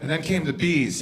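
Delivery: ordinary delivery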